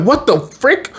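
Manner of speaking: high mock panic; freaked out. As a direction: squeak it out in shock. Ben freaking out.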